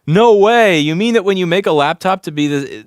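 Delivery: loud, high mock astonishment; sarcastic amazement. Streamer reacts to review of sharp laptop edge, mock amazed.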